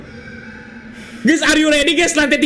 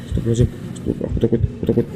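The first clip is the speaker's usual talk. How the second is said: scared whisper; 10 semitones lower, 5 dB quieter, faster than usual. Real, not acted.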